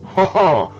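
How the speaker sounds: high loud mock laugh; chuckling. A narrator's reading. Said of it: "he chuckled".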